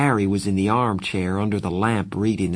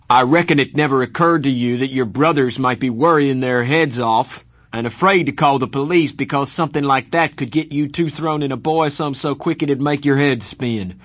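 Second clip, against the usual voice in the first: louder, higher angry lecture; 5 semitones higher, 6 dB louder than usual. Acted. Darry lecturing Pony for sleeping out, angry. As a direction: give him a furious dressing-down.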